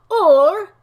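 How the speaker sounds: loud, high dramatic pause word; dramatic reading. Pretend. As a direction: boom it out. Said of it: "read it in a dramatic way".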